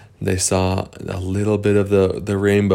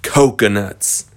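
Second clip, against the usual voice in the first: ordinary delivery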